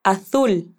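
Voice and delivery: "Azul" said aθul with th; Castilian z. Shown as a demonstration. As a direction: model the th in "Azul" slowly. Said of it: "Intenta repetirlo".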